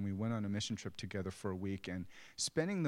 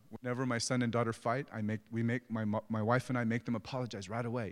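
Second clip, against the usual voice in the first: normal talking voice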